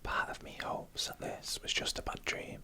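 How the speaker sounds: ordinary delivery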